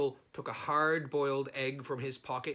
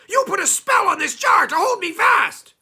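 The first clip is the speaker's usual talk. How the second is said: very high, loud accusing yell; angry goblin shout; 17 dB louder than usual. Acted. Trapped goblin yelling at Herschel about the spell, very high and loud. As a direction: scream the accusation.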